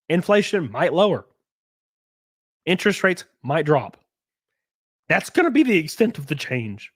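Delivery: normal talking voice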